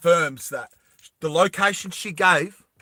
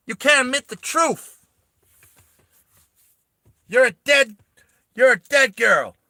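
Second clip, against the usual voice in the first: furious New York yelling, much higher and louder; New York accent; 11 semitones higher, 7 dB louder than usual. Acted. Vash redone as a New Yorker, furious New York shout. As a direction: scream it like an angry New Yorker. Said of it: "he's a New Yorker".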